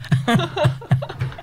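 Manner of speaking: a high rising laugh. Real, not acted. Storyteller laughing at the sock story, high laugh.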